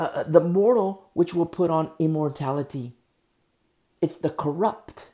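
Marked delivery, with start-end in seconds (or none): 4.0-5.1 slow, weighted stress on "corrupt"; emphasis on corrupt. Real, not acted. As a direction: lean hard on the word "corrupt", drawing the phrase out. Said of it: "emphasis on corrupt".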